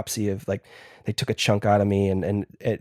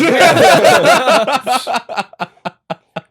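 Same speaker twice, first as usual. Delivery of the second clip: big high burst of laughter; loud high laugh; 14 semitones higher, 11 dB louder than usual. Real, not acted. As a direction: crack up loudly.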